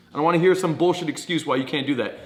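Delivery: usual speaking voice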